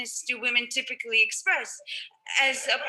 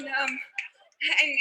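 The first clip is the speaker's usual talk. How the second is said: ordinary delivery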